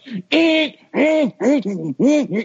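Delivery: high squeaky disc-drive screech; imitated disc drive noise. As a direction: mimic a noisy drive grinding away in high squeals. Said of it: "that disc drive is like".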